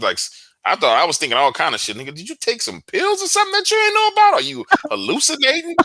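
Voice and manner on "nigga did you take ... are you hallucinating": high-pitched disbelieving questions; incredulous questioning. Retold or imitated. Recounting self-doubt after a wild night, incredulous. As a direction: voice shooting up in baffled disbelief.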